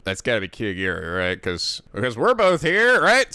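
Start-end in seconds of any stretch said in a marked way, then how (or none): none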